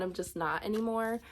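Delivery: usual speaking voice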